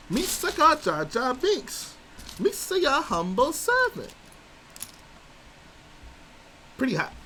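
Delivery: high goofy Gungan voice sung over the beat; squeaky high Jar Jar chant; Jar Jar sing-song. Acted. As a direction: sing it like a silly Jar Jar Binks. Back in the Jar Jar voice.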